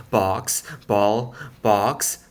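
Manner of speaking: plain reading voice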